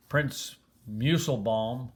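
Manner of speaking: plain reading voice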